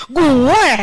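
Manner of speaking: loud, puffed-up "me"; smug boast. Pretend. Proud cook boasting about her food, loud and smug. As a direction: brag like you cooked it yourself.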